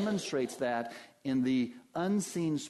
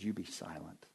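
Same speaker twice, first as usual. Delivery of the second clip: much softer, low and firm; quiet command; 9 semitones lower, 9 dB quieter than usual. Acted. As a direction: hush it down with authority. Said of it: "shuts down this demonic spirit and he says".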